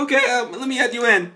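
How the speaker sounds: usual speaking voice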